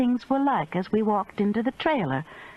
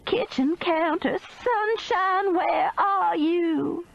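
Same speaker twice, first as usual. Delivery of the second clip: frantic; 10 semitones higher, much slower than usual. A narrator's reading. Panicked search for missing woman.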